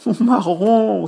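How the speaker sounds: high, loud, slow cry of joy; little boy shrieking with joy at finding his treasure; overjoyed cry. Acted. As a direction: cry out in sheer delight. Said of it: "s'écria Abbé".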